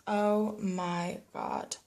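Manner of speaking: normal talking voice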